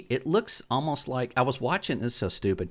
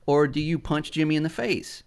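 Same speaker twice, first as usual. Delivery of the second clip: ordinary talk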